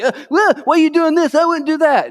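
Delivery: mock high-pitched disapproving voice; whiny critic. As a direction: squeak it like a fussy accuser. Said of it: "People wanna come at you like".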